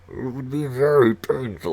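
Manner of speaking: a muffled Bane try through his hand; Bane voice with hand over mouth. Retold or imitated. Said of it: "I can't do it with my hand covering my mouth".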